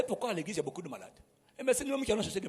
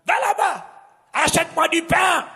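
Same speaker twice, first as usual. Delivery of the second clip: high, loud barked errands; shouted orders; 8 semitones higher, 8 dB louder than usual. Retold or imitated. A preacher mocks someone ordering bread, shouting. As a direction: bark the errand at them. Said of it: "tu peux me dire ça doucement".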